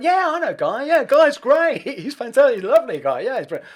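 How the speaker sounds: high, loud enthusiastic gush; gushing praise. Retold or imitated. [name] to [name], loud gushing praise. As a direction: rave about him like an old friend. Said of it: "He went".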